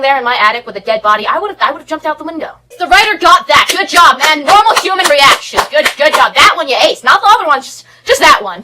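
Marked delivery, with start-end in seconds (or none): 2.8-8.6 loud mock congratulations; sarcastic praise. Real, not acted. Vlogger mockingly praising the writer, loud and sarcastic. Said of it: "sarcastic praise of writer".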